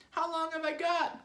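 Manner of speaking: very high, loud squeaky wail; Mrs. Twit's desperate cry. A narrator's reading. Wife begging husband for a prognosis, panicked cry. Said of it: "cried Missus Twit".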